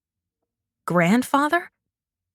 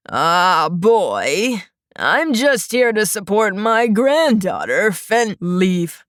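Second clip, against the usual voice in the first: put-on wheezy old man; 2 semitones lower, slower than usual. A narrator's reading. Old man figure in story.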